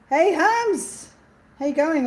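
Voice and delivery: high, loud, delighted hello; excited greeting. Real, not acted. Host spotting a friend in the live chat, excited greeting. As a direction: call out to a friend you spotted.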